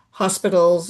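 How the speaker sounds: ordinary talk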